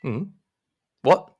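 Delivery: flustered denial of the brick wall. Acted. GM voices Jeremiah bewildered.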